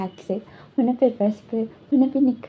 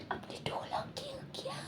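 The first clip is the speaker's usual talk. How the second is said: breathy secret whisper, all air; 19 dB quieter than usual. Acted. Boy asks tutor why he locked the door, whispering. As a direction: hush it so only he hears. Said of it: "धीरे से बोला था".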